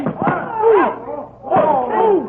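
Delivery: high, rising gasps of wonder at the fight; excited ooh. Acted. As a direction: ooh as the wrestler flies. Watching the wrestling throw.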